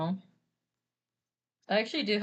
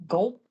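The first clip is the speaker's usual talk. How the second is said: a spoken comic gulp, louder; mock gulp; 3 semitones higher, 6 dB louder than usual. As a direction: say gulp like a nervous cartoon swallow. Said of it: "He's like, "Uh".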